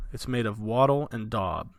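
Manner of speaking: ordinary talk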